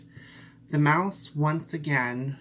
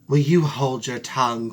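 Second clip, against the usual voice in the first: louder, sharp cat cry; angry cry; 7 dB louder than usual. Acted. Cat silences the mouse, louder and angry. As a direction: cut her off hard. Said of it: "cried the cat".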